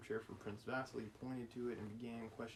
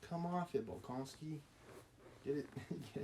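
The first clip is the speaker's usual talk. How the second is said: mock entitled royal; 4 semitones higher than usual. Reader imitating a Russian royal dodging war with Napoleon, mock entitled. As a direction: play the incredulous, pampered young prince, stretched out. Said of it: "He's like".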